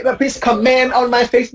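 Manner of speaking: mimicked com-MENT mistake; mis-stressed comment. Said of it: "people say".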